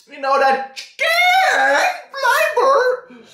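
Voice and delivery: laughing-cry rising high. Real, not acted. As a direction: break into a laugh. Laughing-crying on flavor.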